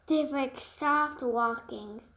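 usual speaking voice

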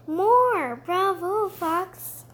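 cheering crowd. Pretend. Fox imagines the cheering crowd.

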